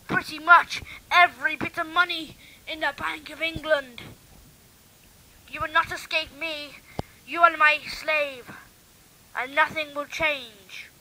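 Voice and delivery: loud, booming villain threat; evil villain. Acted. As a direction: roar it out like a gloating mad scientist, slowing at the end.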